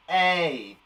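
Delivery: "A" said as a drawn-out "ay"; "A" as the long vowel in "make"; long A sound. Shown as a demonstration. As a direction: model "A" slowly as "ay", like the letter name. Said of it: "It makes the sound of".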